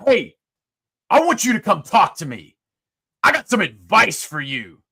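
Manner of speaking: loud, commanding general's call; booming voice. Retold or imitated. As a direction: boom the order like a general. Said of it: "this big booming voice".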